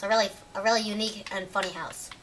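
ordinary talk, nothing marked

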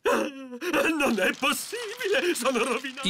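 a stunned, hopeless lament; despairing. Acted. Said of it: "Il direttore è letteralmente disperato".

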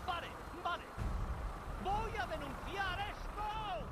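loud, high furious protest; angry shouting. Acted. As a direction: rage at the guard. Man refused entry at the gate. (protesta furiosa)

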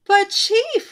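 high, loud whine; whining. A narrator's reading. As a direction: whimper the protest upward. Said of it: "whined the cub they called Scuzz".

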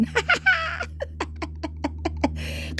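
very high, scornful burst of laughter; mocking laugh. Real, not acted. Vlogger laughing in scorn after mocking the man. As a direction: laugh it off in contempt.

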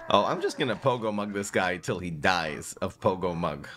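ordinary talk, nothing marked

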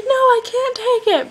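high, loud mock protest. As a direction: squeal it like a polite refusal. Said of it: "I was like".

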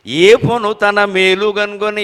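slow, drawn-out recital; verse recitation. Acted. Quoting the poem to the audience, slow and measured. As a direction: stretch the verse words like a chant.

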